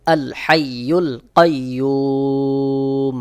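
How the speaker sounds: কাইয়ুম with the ইউ stretched to three alifs, slowly. Shown as a demonstration. Teacher showing the madd arzi on কাইয়ুম. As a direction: draw out the ইউ long before the stop. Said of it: "তিন আলিফ টান দিতে হবে".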